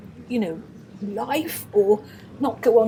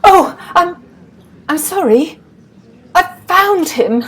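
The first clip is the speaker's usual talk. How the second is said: loud, high, gasped-out news; urgent, out-of-breath apology; breathless arrival; 8 semitones higher, 13 dB louder than usual. Acted. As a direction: burst in winded from searching. Rushes in after searching the pubs.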